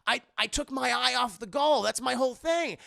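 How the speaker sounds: suddenly inspired, squeaky; excited Goofy voice. Retold or imitated. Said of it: "Goofy realizes, like".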